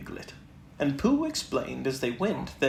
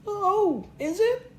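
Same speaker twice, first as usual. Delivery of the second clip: much higher, small timid Piglet; 4 dB louder, slower than usual. Acted. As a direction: squeak it like a tiny nervous pig. Piglet meets Tigger.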